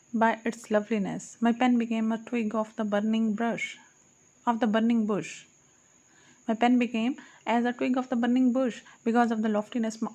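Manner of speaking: usual speaking voice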